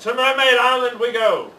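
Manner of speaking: loud, high captain's rallying call; rousing proclamation. A narrator's reading. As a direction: announce the heading to the crew.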